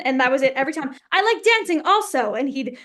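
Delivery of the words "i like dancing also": imitating the French director, much higher and louder; French accent. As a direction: mimic his French accent with gusto. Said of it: "in his French accent".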